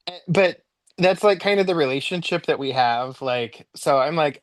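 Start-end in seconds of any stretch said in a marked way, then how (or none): none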